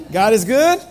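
loud call, rising at the end; call to congregation. Real, not acted. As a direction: call it out to the room. Call and response repeated.

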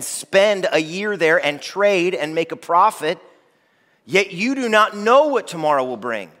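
high, loud impassioned scripture reading; preacher declaiming scripture to the congregation; preaching at full force. Acted. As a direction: thunder the warning from James. Reads James with force.

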